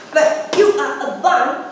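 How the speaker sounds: blunt, loud final insult; loud put-down. Acted. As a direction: tell the prince off. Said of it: "said Elizabeth".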